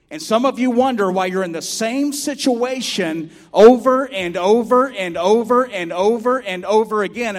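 loud, high, driving sermon voice; preaching at full force. Acted. Pastor preaching to his church at full force. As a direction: preach it hard to the congregation.

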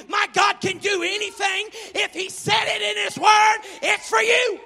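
loud, rising preacher's cry; fiery pulpit shout; preaching at full force. Acted. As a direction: preach it out to the congregation.

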